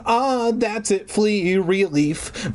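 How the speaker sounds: slow, high sigh of relief. Acted. Dog finally getting scratched, slow and relieved. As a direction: melt into the scratch.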